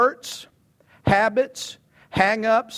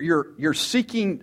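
usual speaking voice

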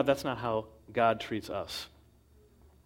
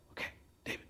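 whisper; 15 dB quieter, much faster than usual. Acted. Men whisper in the camp.

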